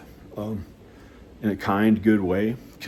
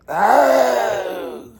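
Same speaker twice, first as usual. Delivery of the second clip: exaggerated cry; 13 semitones higher, 8 dB louder than usual. Quoting his own past thought.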